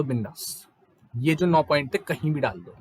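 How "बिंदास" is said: बिंदास repeated much softer, falling; a quiet reassuring repeat of बिंदास; soft echo. Shown as a demonstration. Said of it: "बिंदास डाल दो".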